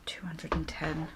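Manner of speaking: breathy aside while reading the dial; murmured reading of the dial; whispered number. Real, not acted. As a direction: whisper the setting under your breath. Reading the temperature setting softly.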